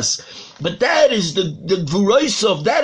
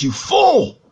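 higher-pitched acted rebuke; acted father's exasperated retort; indignant retort; 6 semitones higher, 4 dB louder than usual. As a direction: snap back at an accuser.

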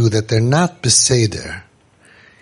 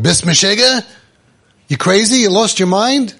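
mock yell; 6 semitones higher than usual. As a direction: scold the old man. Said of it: "what would you do".

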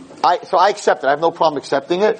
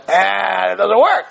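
raised, dismissive mock groan; mock dismissal; 5 semitones higher, slower than usual. Rabbi imitating his baffled students, mock dismissive. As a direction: voice the doubters, pitched up. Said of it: "you're all looking at me like".

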